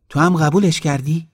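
appalled he accepted it; incredulous. Acted.

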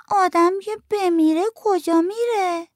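put-on high, slow, wondering child question; little girl voice. A narrator's reading. Little girl asks her aunt where people go when they die. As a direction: wonder aloud slowly like a small girl.

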